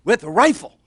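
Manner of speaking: wasted brainstormer. Acted. Stoned inventor talking friends into a sport, insistent. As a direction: push the idea like an excited drunk, slowing into it. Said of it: "They smoke marijuana, drink vodka all day".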